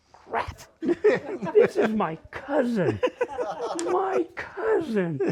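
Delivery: laughing through the punchline, slowing down; amused disbelief with laughter. Real, not acted. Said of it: "laughing realization".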